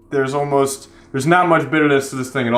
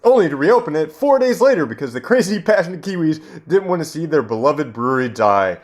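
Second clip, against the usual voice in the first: normal talking voice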